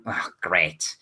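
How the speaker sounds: low, softened mumble; quiet aside to oneself, pitch dropping; mumbling to himself. Acted. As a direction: mumble it under your breath.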